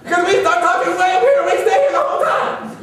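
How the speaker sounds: high, loud shouting from start to end; comically maxed-out high yelling; no room for crescendo. Acted. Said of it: "there is no room for crescendo".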